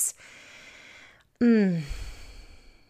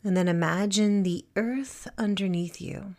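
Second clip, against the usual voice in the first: guided meditation. Acted. Teacher guiding listeners to feel supported and present, calm meditation voice. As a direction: settle them gently into stillness, phrase by phrase.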